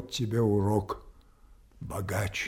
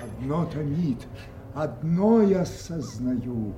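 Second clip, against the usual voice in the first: building anguished cry; 8 semitones higher, 6 dB louder, slower than usual. Acted. Lear raging in the storm.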